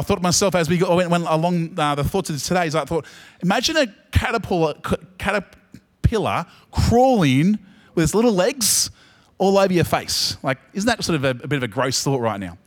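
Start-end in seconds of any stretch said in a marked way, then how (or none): none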